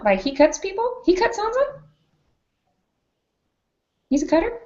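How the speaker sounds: high, incredulous questions; mock shock. Real, not acted. High incredulous voice, reacting to the cutting line. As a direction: sound scandalized and amused.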